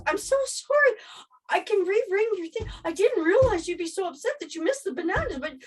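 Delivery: very high, panicky apology; high-pitched mock panic; imitated panicking. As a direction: act out a flustered cashier apologizing frantically. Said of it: "And she's panicking".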